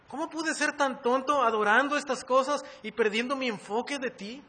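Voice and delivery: impassioned acted prayer, high and loud; sincere confession. Preacher confessing idolatry to the Lord, impassioned plea. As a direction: plead with God in loud, self-reproaching remorse. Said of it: "tenemos que ser sinceros y decirle al Señor".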